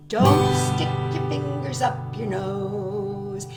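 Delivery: ordinary delivery, nothing marked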